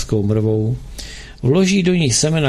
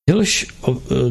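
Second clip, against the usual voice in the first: ordinary delivery, nothing marked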